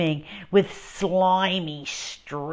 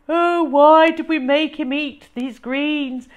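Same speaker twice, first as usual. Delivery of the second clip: higher, louder hammed-up lament; a father wallowing in guilt; moaning; 7 semitones higher, 7 dB louder than usual. A narrator's reading. Dad imagined grieving over the greens, hammed-up moan. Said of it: "Dad would moan".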